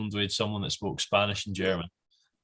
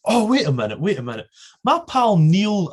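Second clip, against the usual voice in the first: high, loud burst of recognition; mock excited realisation; 9 semitones higher, 6 dB louder than usual. Stranger thinking a Scot must know his pal, mock excited. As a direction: imitate someone suddenly sure of a connection.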